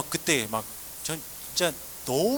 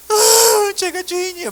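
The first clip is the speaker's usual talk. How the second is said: loud high wailing cry of guilt; sobbing confession; 10 dB louder, slower than usual. Retold or imitated. Boy sobbing to the youth pastor that he is a sinner, wailing.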